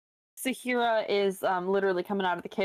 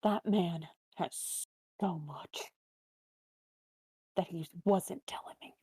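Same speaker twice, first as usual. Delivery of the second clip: softer, slow, conspiratorial whisper; 6 dB quieter than usual. Acted. Saheera whispering to Leaf about the man's secrets. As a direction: keep it just between the two of them.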